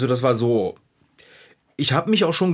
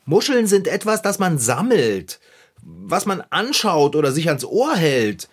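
ordinary talk, nothing marked

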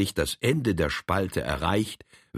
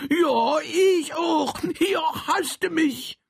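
high, slow, crying out; tearful joy; slower than usual. Acted. Troll answers the embrace, high tearful shout. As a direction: sob it out in happy tears, high.

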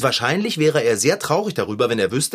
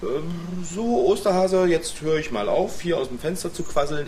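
normal talking voice

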